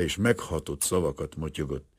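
ordinary delivery

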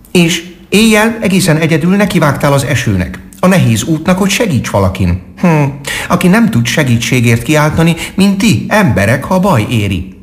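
high-pitched little squirrel character; friendly squirrel. A narrator's reading. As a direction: speak up high as a cheerful squirrel.

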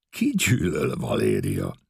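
slow, amused question said through a smile; smiling question. A narrator's reading. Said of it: "Mosolyodott el".

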